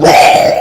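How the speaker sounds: loud breathy roar; dragon roar. Acted. Dragon peering through window, loud breathy roar. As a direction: roar like a monster.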